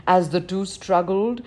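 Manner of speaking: ordinary delivery, nothing marked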